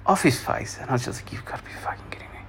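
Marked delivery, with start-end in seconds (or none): 1.3-2.4 fast, very quiet whisper; rapid exasperated whispering; whispered disbelief. Retold or imitated. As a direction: hiss it under your breath, incredulous. Retold disbelief at drunk cousin.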